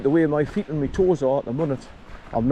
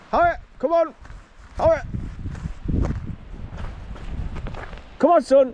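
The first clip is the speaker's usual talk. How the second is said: calling out; 12 semitones higher than usual. Real, not acted. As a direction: shout him down off the hill. Calling dog down from hill.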